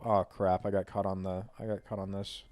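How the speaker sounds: low, soft aside about a snag; muttering to self. Real, not acted. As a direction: drop to an absent, quiet mumble. Snagged while driving, talks to self.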